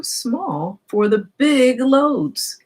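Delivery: slow, weighty stress on small and big; storyteller leaning hard on the words to a child; drawn-out emphasis. A narrator's reading. Truck too small for the city.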